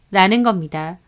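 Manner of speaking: ordinary delivery, nothing marked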